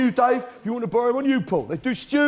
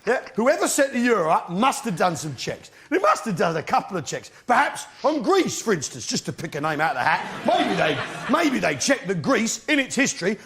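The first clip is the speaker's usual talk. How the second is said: ordinary delivery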